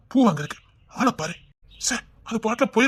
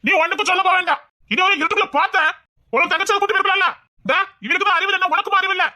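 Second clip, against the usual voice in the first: high, heated telling-off; angry scolding; 9 semitones higher than usual. Acted. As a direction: scold her with pitch raised right up. ஏன் கோபப்படுறீங்க. (கோபமாக உயர்ந்த குரலில் திட்டுதல்)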